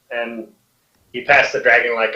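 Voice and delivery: usual speaking voice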